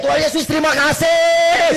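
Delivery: high, loud shout of thanks; teriak syukur. Retold or imitated. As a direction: cry out thanks at full volume, as if running. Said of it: "Saya lari, teriak". (teriakan syukur yang lantang)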